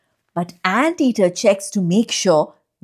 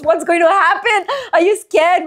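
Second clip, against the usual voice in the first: playful suspense; 9 semitones higher, 3 dB louder than usual. Real, not acted. Mock suspense to children.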